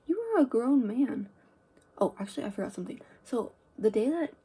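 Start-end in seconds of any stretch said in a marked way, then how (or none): 0.1-1.3 louder, indignant telling-off; indignant, pushing the volume up; indignant retort. Retold or imitated. Student telling off her teacher in a story, indignant.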